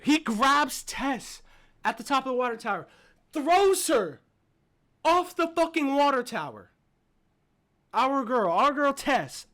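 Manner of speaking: loud, high, punched phrases with pauses; shocked, emphatic recap. Real, not acted. Fan retelling the heroine being thrown from the tower, loudly appalled. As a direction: hammer each phrase in outraged amazement.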